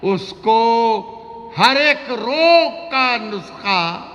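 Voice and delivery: high, slow drawn-out verse; romantic shayari. Acted. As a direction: stretch the line out high. Poet recites ghazal.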